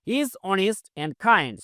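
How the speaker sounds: very slow, word by word; spaced-out classroom read; slow model reading. Shown as a demonstration. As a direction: dictate each English word slowly for learners. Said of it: "slow model sentence".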